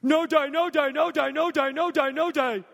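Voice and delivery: put-on a scared little boy begging his father to stop; panicked child pleading. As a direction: plead in a toddler's terrified voice. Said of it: "He said".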